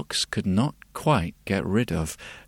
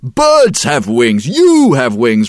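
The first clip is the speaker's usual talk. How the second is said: gruff ox; 5 dB louder than usual. Acted. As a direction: protest like a stubborn ox. Ox rejects the idea.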